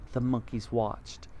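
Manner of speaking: ordinary delivery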